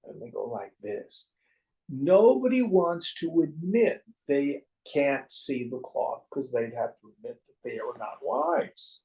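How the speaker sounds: ordinary talk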